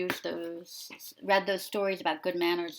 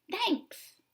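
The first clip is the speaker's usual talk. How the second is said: much higher, squeaky pigeon thanks; 13 semitones higher than usual. Acted. Teacher voicing the pigeon saying thanks, high and squeaky. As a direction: toss off a quick cartoon thanks. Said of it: "he says".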